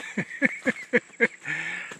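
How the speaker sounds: short winded chuckle; breathless laugh. Real, not acted. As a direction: laugh at the steep stairs. Laughing at the steep stairway.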